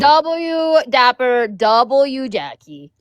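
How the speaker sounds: very slow, stretched shout-out; drawn out. Acted. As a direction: draw each name out long. Drawn-out chant to chat.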